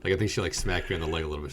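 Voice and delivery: ordinary talk, nothing marked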